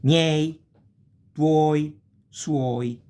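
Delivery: Miei said loud and high as a model; teacher modelling triphthong words; Italian model words. Shown as a demonstration. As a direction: announce Miei, tuoi, suoi clearly. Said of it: "like miei".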